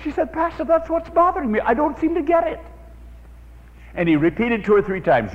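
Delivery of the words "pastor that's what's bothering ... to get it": a higher-pitched, lightly voiced worried woman; a hint of an anxious church member; troubled woman. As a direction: plead with the pastor, puzzled.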